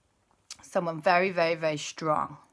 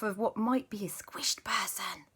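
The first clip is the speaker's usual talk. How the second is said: whispered aside; hushed grim whisper; 4 semitones higher, 6 dB quieter than usual. Acted. Aunt Lisa telling Superman the grim part, whispered.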